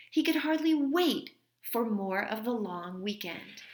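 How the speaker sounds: gentle read-aloud storytelling voice; story reading. A narrator's reading. Teacher reading a story to her class, gentle read-aloud. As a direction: read it like a teacher sharing a picture book with kids. Reads a picture book aloud.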